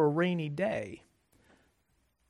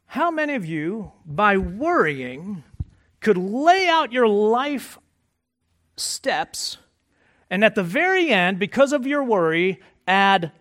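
building emphasis; 3 semitones higher, 7 dB louder than usual. Real, not acted. Preacher building to climax.

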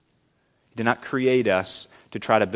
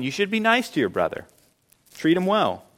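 higher-pitched parental coaxing, lightly imitated; a hint of mom or dad, pitched up; lightly voiced parent; 6 semitones higher, 3 dB quieter than usual. Parents telling a child to treat his brother well, lightly imitated.

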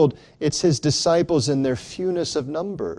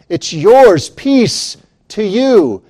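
louder, higher ringing proclamation; proclaiming peace; 6 semitones higher, 9 dB louder, slower than usual. Acted. Risen Christ to his disciples, ringing joyful proclamation. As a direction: throw the gift out with joy.

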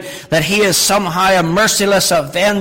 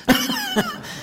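short high burst of laughter; 4 dB quieter than usual. Real, not acted. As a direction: laugh at the joke. Laughing after the anecdote.

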